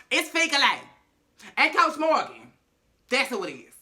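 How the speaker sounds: high, loud announcement of names; Wendy exposing the pair to reporters, loud and high; loud reveal. Retold or imitated. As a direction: blurt the names out to the press. Wendy outing them to press.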